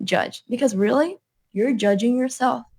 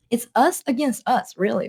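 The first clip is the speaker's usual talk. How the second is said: usual speaking voice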